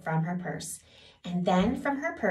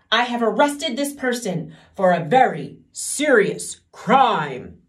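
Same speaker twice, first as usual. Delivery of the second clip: stiff soldier; 2 semitones higher, 8 dB louder than usual. Acted. Soldier reports to the king.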